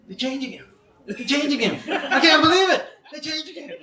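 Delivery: can't believe his eyes, laughing; amazed. Retold or imitated. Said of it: "He says".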